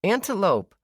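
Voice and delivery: put-on high, loud excited little girl; delighted shout of discovery. A narrator's reading. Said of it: "Olive said excitedly".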